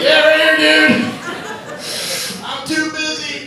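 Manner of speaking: high, loud, then slow and dazed; mock stoner shrug; stoner lady impression. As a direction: play the spaced-out stoner brushing it off. Imitating the pot-smoking audience lady.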